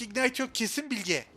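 ordinary talk

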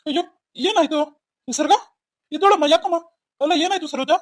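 high-pitched, urgent questioning; alarmed. Acted. Father rushes to his collapsed daughter, alarmed. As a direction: rush in startled and worried.

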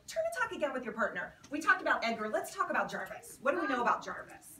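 ordinary delivery

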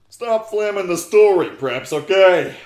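loud, indignant telling-off; mock scolding. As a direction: yell at the haters. Author scolding reviewers.

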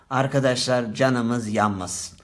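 ordinary talk

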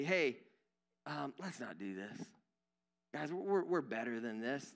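soft, low, gentle correction; loving, tactful. Acted. Coworker correcting someone inappropriate in a meeting, lovingly tactful. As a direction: ease in hesitantly and quietly, pleading kindly. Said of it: "very lovingly and appropriately say".

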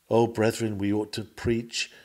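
ordinary talk

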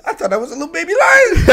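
loud, high-pitched outburst over something adorable; mock-tearful gushing. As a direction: squeal it, overcome by cuteness. Gushing over the baby lion film scene.

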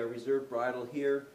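ordinary talk, nothing marked

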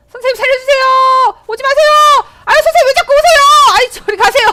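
high, loud playful shrieks to go away; hammed-up terror at the chasing enemy; mock panicked shouting. 적에게 쫓기며 외침. (적에게 쫓기며 장난스럽게 높고 크게 비명)

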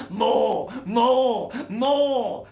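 very loud, high repeated demand; screaming cub. Acted. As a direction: bellow each one as a separate cry. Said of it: "screamed the fourth".

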